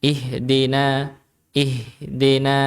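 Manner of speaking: "ihdina" as "ihdeena" with e for i; tilted dal vowel. Shown as a demonstration. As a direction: slowly say "ihdina" with "de" in the middle. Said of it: "jangan sampai bacanya D".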